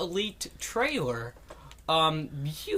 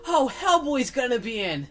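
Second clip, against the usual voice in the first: put-on young kid voice; 8 semitones higher than usual. Retelling his childhood excitement.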